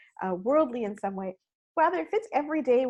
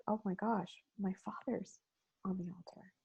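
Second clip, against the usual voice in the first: fading, hushed dread; dawning dread; 3 semitones lower, 9 dB quieter than usual. Retold or imitated. A teenager realizing her father is hurt, hushed dread. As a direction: realize in horror, voice sinking.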